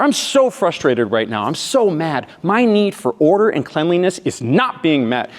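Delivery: acted vitriol. Girlfriend states her unmet need, acted vitriol. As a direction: start frustrated and drive up to emphatic anger. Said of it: "Same vitriol as before".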